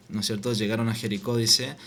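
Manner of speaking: usual speaking voice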